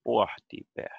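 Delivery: "Pohti, behtet" strongest on "Poh", lighter on "beh"; stronger initial stress. Shown as a demonstration. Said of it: "more stronger in the start".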